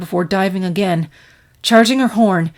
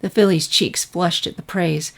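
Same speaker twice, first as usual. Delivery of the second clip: usual speaking voice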